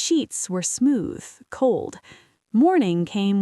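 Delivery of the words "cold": plain reading voice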